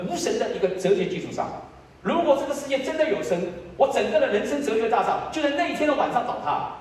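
high, insistent argument; impassioned preaching. Real, not acted. Preacher confessing his godless philosophy would collapse, impassioned. Argues forcefully from his testimony.

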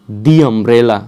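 the umbrella, "the" said "dee". Shown as a demonstration. Teacher recapping examples for learners, "dee umbrella". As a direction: stretch "the" to "dee" before umbrella. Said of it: "এটার ক্ষেত্রে কী ছিল?".

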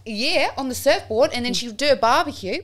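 animated, lilting Australian; hammed-up Australian accent. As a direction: gossip indignantly in mock Aussie. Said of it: "I can only do Australian".

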